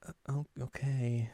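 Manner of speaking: small, soft, hesitant reply; quiet, let-down mumble; deflated acceptance. Acted. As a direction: shrink into a reluctant okay. Aimu's deflated reply after Setsuna.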